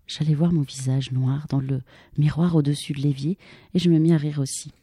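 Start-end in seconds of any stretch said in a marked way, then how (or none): none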